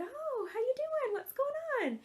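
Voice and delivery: high, slow sing-song hello; friendly greeting. Retold or imitated. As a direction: greet a friend at the door warmly. Neighbors greet at door.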